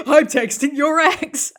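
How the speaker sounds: high, loud, slow laughing mockery; mock his accusation through laughter. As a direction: laugh while quoting him. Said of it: "reading his accusation mockingly".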